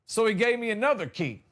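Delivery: usual speaking voice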